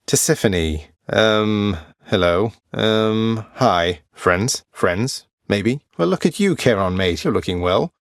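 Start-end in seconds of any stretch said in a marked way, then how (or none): none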